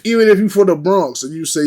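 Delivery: ordinary talk, nothing marked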